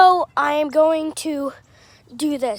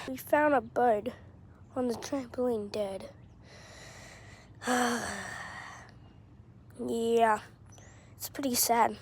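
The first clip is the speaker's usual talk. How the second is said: hushed, sad whisper; 4 semitones lower, 12 dB quieter than usual. Real, not acted. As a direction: whisper it, saddened. Telling of the dead bird.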